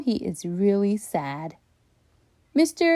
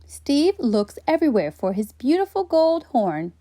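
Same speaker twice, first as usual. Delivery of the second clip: usual speaking voice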